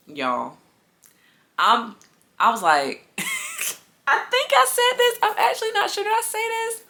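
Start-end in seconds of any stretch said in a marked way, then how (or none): 3.2-3.7 high-pitched embarrassed giggle; laughing at her own pettiness, high squeal. Real, not acted. Laughing at herself. 4.1-6.8 high giggly voice, unsure; admitting to viewers, laughing high; laughing while unsure. Real, not acted. Laughing, unsure what she said.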